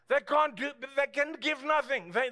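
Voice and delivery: ordinary talk, nothing marked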